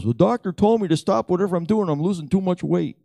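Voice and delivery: high, loud excited telling; amazed report. Retold or imitated. Friend passing on the doctor's warning, high and excited. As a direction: tell it like you can't believe it yourself.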